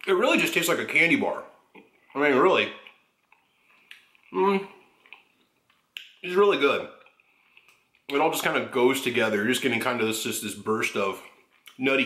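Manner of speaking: chewing while talking; speaking between bites; mouth full of cake. Real, not acted. Seen 0: Bites piece at -1.0, then chews with cheeks puffed while speaking.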